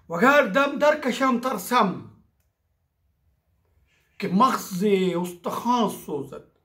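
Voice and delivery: measured Persian verse recital with pauses; poetry recitation. Acted. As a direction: recite the couplet phrase by phrase.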